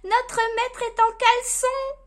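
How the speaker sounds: high, loud mocking cry from a reindeer; gleeful exclamation. A narrator's reading. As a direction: exclaim it shrieking with glee. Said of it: "s'exclame un renne".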